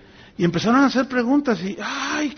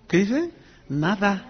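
normal talking voice

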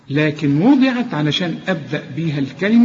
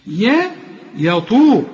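usual speaking voice

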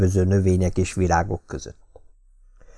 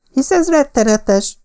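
much higher, slow, louder cry of horror; horrified shriek; 4 dB louder than usual. A narrator's reading. Patricia shrieking in horror at the dead dog. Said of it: "sikoltotta Patricia".

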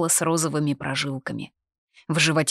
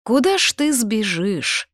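higher, drawn-out agreement; agreeing; 6 semitones higher, 4 dB louder than usual. A narrator's reading. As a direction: agree slowly, pitched up. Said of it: "согласился Эргес". (соглашаясь, протяжно и выше)

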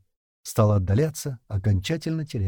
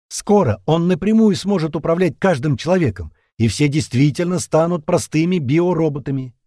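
raised, louder, urgent warning; heated; 7 semitones higher, 6 dB louder than usual. A narrator's reading. Captain warns that all will become biorobots, heated. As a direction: press the warning home with heat. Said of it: "разгорячился капитан".

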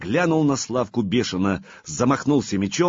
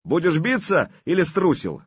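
taunting challenge; 8 semitones higher than usual. Acted. Artyom taunts Vladimir.

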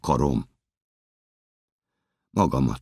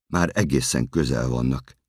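ordinary delivery

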